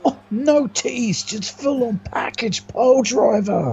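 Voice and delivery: high, excited commentary. Real, not acted. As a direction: marvel at the move. Co-commentator reacts to finish.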